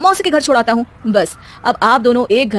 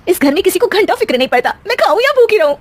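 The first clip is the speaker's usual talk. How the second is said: much higher, indignant self-pity; hurt and angry; 9 semitones higher than usual. Acted. Daughter-in-law venting hungry and ignored, indignant. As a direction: vent alone in the kitchen.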